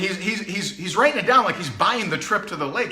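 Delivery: usual speaking voice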